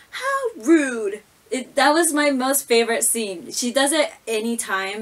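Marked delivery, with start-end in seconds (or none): 0.1-1.2 raised pitch sassy "How rude"; a quick sassy mock of the catchphrase; imitating Stephanie. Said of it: "she crosses her arms and then she looks".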